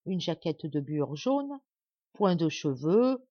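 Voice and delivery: ordinary delivery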